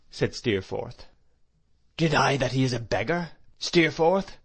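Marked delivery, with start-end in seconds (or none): 2.0-4.4 raised, louder challenge, climbing on the name; crying out. A narrator's reading. Headmaster challenging the boy over the beggar charge, crying out. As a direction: exclaim it as an astonished question. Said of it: "cried Mister Creakle".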